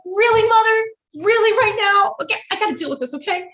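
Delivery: fed up with her mother; exasperated. Retold or imitated. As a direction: daughter snapping back at her mom.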